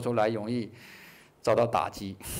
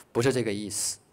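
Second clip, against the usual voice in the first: ordinary talk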